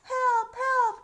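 high loud cry for help. Acted. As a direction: shout it out like a panicked page. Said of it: "cried the page".

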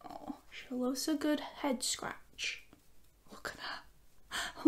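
soft whisper over the dog. Real, not acted. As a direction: hush it while she pets the dog. Petting the dog.

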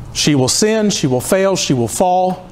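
normal talking voice

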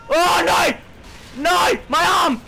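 hammed-up gamer shout of pain. Real, not acted. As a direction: cry out as if your arm is hurt. Character hurt in game, shouting.

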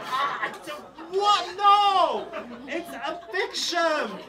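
high slow laughing outcry; laughing protest. Retold or imitated. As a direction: deny the murder through laughter. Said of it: "And I was like".

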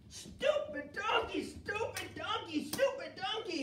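high, slow, drawn-out angry shout; mad Balaam. Acted. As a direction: bellow at the donkey in a rage. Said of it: "Balaam got mad".

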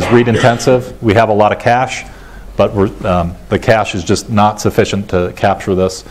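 ordinary talk

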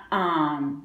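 ordinary talk

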